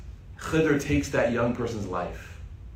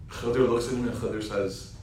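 ordinary talk, nothing marked

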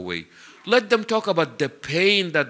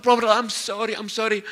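higher-pitched pleading, lightly acted; urgent apology; 6 semitones higher than usual. Preacher voices woman apologizing to the Prophet, urgent apology. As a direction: plead for pardon in a raised voice.

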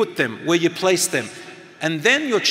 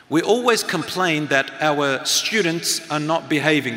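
ordinary talk

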